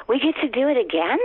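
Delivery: high, rising question of mock disbelief; retold delighted surprise. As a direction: ask it like an unexpected treat. Acts out thrilled soap actors.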